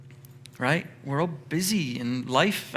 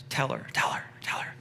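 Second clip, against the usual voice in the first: whispered, repeated urging; insistent whisper; 7 dB quieter than usual. Acted. Martha nagging Jesus about her sister, insistent whisper. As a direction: hiss it urgently under the breath.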